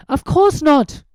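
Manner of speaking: put-on high, firm girl's dismissal; confident Hermione. A narrator's reading. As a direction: brush the worry aside brightly.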